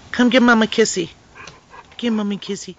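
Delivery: loud sing-song call to a pet. Real, not acted. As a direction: call the dog over from across the room. Calling the pet over.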